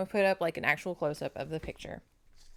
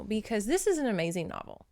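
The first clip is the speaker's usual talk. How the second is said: normal talking voice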